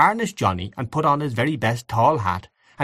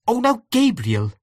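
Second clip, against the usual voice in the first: high-pitched, merry objection; amused protest; 13 semitones higher than usual. A narrator's reading. Aunt Kate objecting to Gabriel's story, amused.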